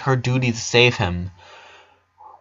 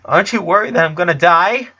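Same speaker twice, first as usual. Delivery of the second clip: higher, louder anxious question; a little upset; 7 semitones higher, 5 dB louder than usual. A narrator's reading. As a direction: let the worry break through. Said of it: "suddenly a little upset".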